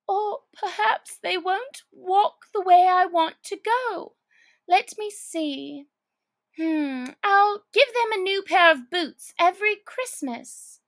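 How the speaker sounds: put-on high girlish thinking aloud; lightly voiced Alice planning; Alice musing. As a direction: puzzle it out like a child.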